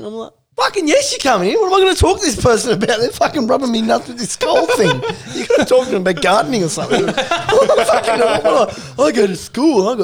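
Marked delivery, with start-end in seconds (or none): 0.6-5.0 mock panicked plea. Retold plea for mum to come in.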